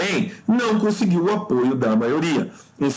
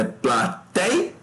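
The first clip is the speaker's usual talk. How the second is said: plateia slowly split pla-tei-a; syllable by syllable; 5 semitones higher than usual. Shown as a demonstration. Teacher checks for a triphthong, plateia slowly in syllables. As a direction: hold each syllable of plateia apart. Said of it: "como é que separa".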